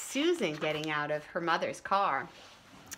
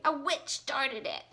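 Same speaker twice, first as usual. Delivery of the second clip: tearful complaint; 6 semitones higher, slower than usual. Acted. Susan complaining to teacher.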